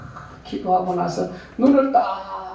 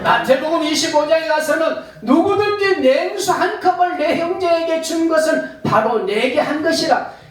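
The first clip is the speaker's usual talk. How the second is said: high, loud preaching voice, slowing on the gift; full-force preaching; 11 semitones higher, 4 dB louder than usual. Acted. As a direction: proclaim it to the congregation. Preaching the Gospel passage. (높고 힘찬 설교)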